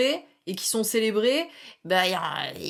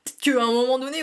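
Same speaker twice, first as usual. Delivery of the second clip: usual speaking voice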